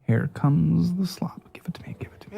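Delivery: eager whisper; gleeful gossip whisper. Real, not acted. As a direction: hush it out, hungry for gossip. Gleeful anticipation of text drama.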